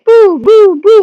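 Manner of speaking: high, loud mock boos; high squeaky heckling boos; Dolo booing. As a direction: boo him in a squeaky put-on voice. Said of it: "what's Dolo the Pilot Man doing?".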